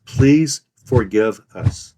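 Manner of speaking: PLEASE, FORGIVE, US each stressed slowly and clearly; slow "Please forgive us", every word plain and distinct; stressed, true remorse. Shown as a demonstration. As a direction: plead slowly, weighting "Please", "forgive" and "us" separately. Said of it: "stress each of the following three words plainly and clearly and use true remorse".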